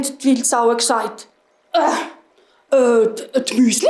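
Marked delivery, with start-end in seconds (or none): 1.7-3.9 stammering boar voice; hesitant boars. A narrator's reading. Wild boars blame the mice, halting grunty stammer. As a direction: grunt out a halting, unsure answer.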